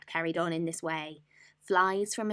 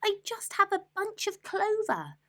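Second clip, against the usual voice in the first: timid rabbit. Acted. Rabbit answering the robber rat, timid squeak. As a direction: squeak it out small and scared. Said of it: "the rabbit replied".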